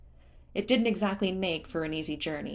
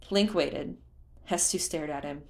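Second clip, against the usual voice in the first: ordinary delivery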